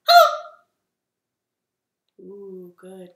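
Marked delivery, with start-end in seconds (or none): 0.1-0.3 fast hello. Shown as a demonstration. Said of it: "as fast as we can".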